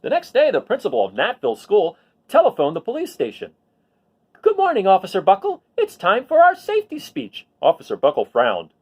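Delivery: lively storybook read-aloud; reading to children. A narrator's reading. Teacher reads a picture book to the class, lively storybook voice. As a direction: tell the story to a class of kids.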